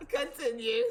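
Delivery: laughing mock "continue"; playful laughing quote. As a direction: toss it off through a laugh. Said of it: "You was like".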